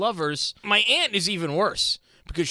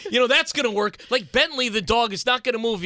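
ordinary delivery

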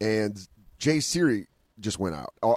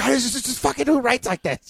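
frustrated outburst; 14 semitones higher than usual. Retold or imitated. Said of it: "And I'm like".

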